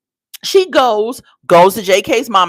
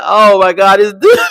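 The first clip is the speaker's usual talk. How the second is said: laughing loudly; 5 dB louder than usual. Real, not acted. Laughing at dad's outburst.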